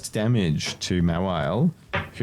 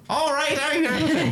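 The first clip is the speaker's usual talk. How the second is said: put-on Irish accent; slower than usual. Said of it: "That was the worst Irish I've ever done".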